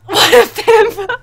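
a loud teasing exclamation; loud amused exclaim. Real, not acted. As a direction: crow it out amused. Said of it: "reacts to chat".

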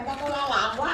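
laughing through the words; laughing while talking. Real, not acted. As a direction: chuckle while speaking. Cười khi kể chuyện. (vừa nói vừa cười)

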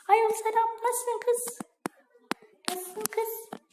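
put-on high falsetto girly greeting; chirpy high girl on the phone. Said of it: "Kız sesi yapacağım". (tiz kız sesiyle selam)

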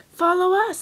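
cried out. Acted. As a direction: call back while running off. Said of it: "they cried".

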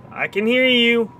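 high taunt, stress on hear; high sing-song taunt. Pretend. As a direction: lean on hear, teasing. Taunting repeated line.

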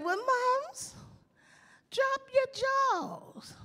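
put-on very high, slow prissy girl; high girl's voice. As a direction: squeak it like a prim young girl. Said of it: "She said".

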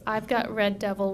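ordinary talk, nothing marked

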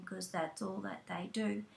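normal talking voice

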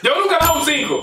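high, fast excited declaration. Real, not acted. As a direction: blurt it out thrilled.

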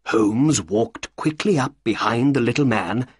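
usual speaking voice

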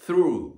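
through with the tongue lightly between the teeth on th; English through. Shown as a demonstration. Teacher showing students, careful English through. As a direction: through said with a bitten-tongue th and a rolled ru. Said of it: "舌を軽く噛んで".

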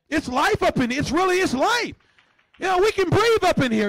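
high, loud, rising exclamation; preaching excitement. Real, not acted. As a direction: build to an excited preacher's cry. Preacher building excitement about living church.

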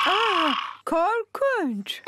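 slow hammed-up gasp, laughing through it; mock fright. Mummy pretending the toy dinosaur scares her, slow laughing mock fright. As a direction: pretend to be scared, then giggle.

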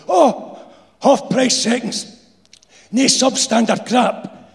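high, slow Glaswegian wheeze; old insulator voice. A narrator's reading. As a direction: drawl it as the old worker. Insulator replying.

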